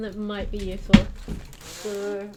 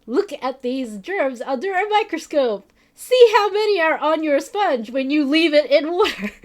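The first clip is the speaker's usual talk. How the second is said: louder, pushy lecturing tone; aggressive; 5 semitones higher, 9 dB louder than usual. Retold or imitated. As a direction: lecture him forcefully. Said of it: "that was like".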